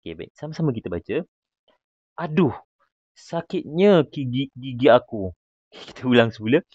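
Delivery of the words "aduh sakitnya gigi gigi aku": higher-pitched, slow toothache moan; acted aching cry; in pain. Shown as a demonstration. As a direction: complain as if your tooth really hurts. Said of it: "gunakan intonasi sakit".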